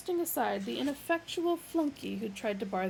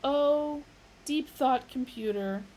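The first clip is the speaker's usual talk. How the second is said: solemn address; 5 semitones higher, 5 dB louder, slower than usual. A narrator's reading. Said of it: "he said".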